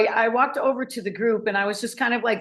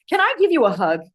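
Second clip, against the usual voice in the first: a bright, high, louder eager offer of a hug; 8 semitones higher, 6 dB louder, faster than usual. Retold or imitated. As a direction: pitch it up like a happy stranger asking for a hug. Guy offers hug, retold.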